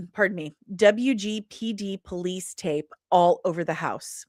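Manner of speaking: plain reading voice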